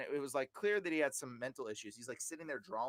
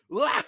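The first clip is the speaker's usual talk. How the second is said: loud, high burst of mock laughter; imitated demonic laugh; 9 semitones higher, 13 dB louder than usual. Imitating a person laughing during prayer, loud demonic cackle. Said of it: "The people will laugh".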